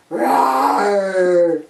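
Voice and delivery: low, drawn-out imitated roar; lion roar. Lion answering the boy, low imitated roar. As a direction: growl out a long low roar.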